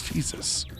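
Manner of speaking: breathy whispered "Jesus". Real, not acted. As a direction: whisper it under your breath. Whispered reaction.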